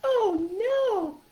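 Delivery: very high, slow puppet voice; high-pitched, drawn-out animal character; stuffed animal voice. Shown as a demonstration. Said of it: "You're changing your voice a little bit".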